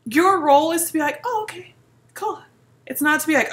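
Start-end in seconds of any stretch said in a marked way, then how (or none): 1.2-2.4 high, soft acted agreement; reply high and agreeable, imitating a supportive listener; mock-breezy okay; easy acceptance.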